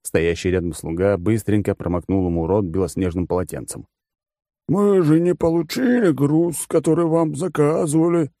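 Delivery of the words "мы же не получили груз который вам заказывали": bored rich glutton explaining terms; smug fat oligarch. Acted.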